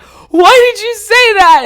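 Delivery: high, loud, stretched wail; imitating crying-laughing. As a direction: squeal it out as if dying of laughter. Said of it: "in the middle of just crying laughing".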